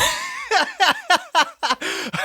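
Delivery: high burst of real laughter; laughing. Real, not acted. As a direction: crack up in a long, high laugh. Cracks up over Dylan's gulp.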